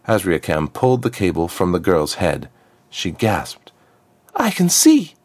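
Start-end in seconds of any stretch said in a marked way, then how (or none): 4.3-5.1 much higher and louder, sudden joy; joyful girl cry. Acted. Freed girl cries out as her sight returns, high and loud. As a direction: burst out with relief at seeing again.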